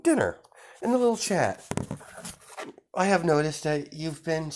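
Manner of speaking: sugary welcome hiding a threat; sweet sister voice. Acted. Sister welcomes heroes, very high.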